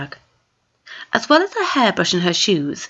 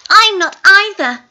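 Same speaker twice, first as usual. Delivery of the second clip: put-on eager girl; 12 semitones higher, 6 dB louder than usual. Gwendolen agreeing.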